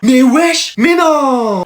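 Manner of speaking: high, loud astonished protest; loud disbelief. Acted. Student to the punctual latecomer, loudly incredulous. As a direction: blurt it out in disbelief. Classmates stunned by latecomer on time.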